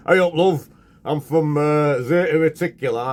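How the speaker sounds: loud, high hammed-up Yorkshire alien; mock northern English accent. Host imagines a northern English alien, hammed-up northern accent. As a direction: greet them like a cheery northern local. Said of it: "A, a northern alien".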